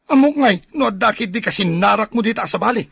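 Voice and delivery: loud, high accusing outburst; jealous accusation. Acted. Jealous husband accuses his wife of other men, loud and angry. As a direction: accuse her hotly.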